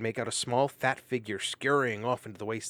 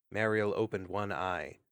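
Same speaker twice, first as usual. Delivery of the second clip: ordinary delivery, nothing marked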